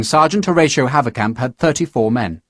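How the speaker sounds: plain reading voice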